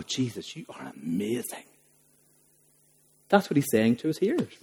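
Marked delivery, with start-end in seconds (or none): 0.0-1.6 hushed and much softer, low awe; awed hush. Pretend. Hearers marvelling at Jesus, in a hushed awed voice. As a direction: breathe it like a stunned listener. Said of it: "will stand back and go".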